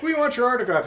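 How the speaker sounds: put-on children begging a rock star; eager kids. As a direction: squeal it like excited fans. Kids asking for autograph.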